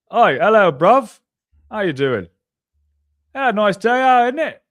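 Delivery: loud, high-pitched mock British greeting; English accent impression. Shown as a demonstration. Showing cohost his English accent, exaggerated mock British. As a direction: ham up a cheery English "bruv" accent. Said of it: "Let me see yours".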